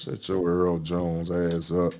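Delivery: mock deep James Earl Jones rumble, slow and soft. Teasing friend, mocking his deep voice, in a low slow rumble. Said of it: "there your ass goes".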